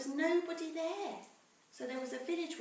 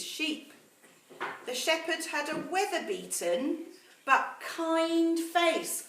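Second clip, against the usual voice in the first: put-on slow, measured storytelling to children; story narration; 4 semitones higher, 7 dB louder, slower than usual. A narrator's reading. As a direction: read it like a bedtime story to the class. Teacher reads story to class.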